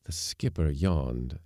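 ordinary delivery

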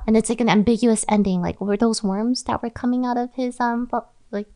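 ordinary talk

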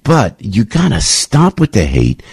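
ordinary talk, nothing marked

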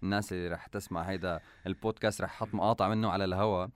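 ordinary talk, nothing marked

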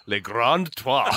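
slow, grand mock French; French accent. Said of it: "You can always do a French accent".

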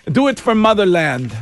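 rally them in a put-on Russian accent. Officials urging volunteers on, mock Russian accent.

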